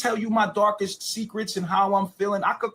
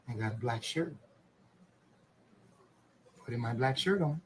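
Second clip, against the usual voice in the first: Mister Rogers voice; 7 semitones lower than usual. Pretend. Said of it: "Mister Rogers".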